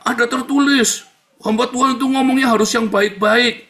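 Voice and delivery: high, loud imitated admonition; mock preachy critic. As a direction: proclaim the rule like a self-righteous objector. Acts out critics citing scripture.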